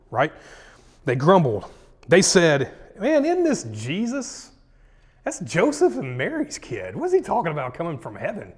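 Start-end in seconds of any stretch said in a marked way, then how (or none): 3.0-8.5 high, scoffing crowd complaint; incredulous grumbling. Acted. Townsfolk doubting the local kid Jesus, incredulous grumbling. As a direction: pitch it up in mock disbelief.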